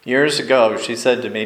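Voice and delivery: normal talking voice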